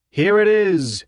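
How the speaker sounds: high, loud orator's cry; rally speaker crying to the crowd; cried out. A narrator's reading. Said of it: "he cried".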